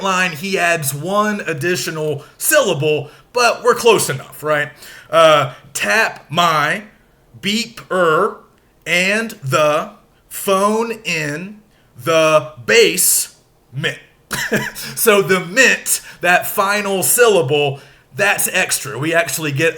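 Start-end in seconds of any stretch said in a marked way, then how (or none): 5.7-14.0 slow, stressed beats a bit louder; spaced syllables with a steady beat; scanned in iambs. Shown as a demonstration. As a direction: count out each iamb. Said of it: "break it down going unstressed to stressed".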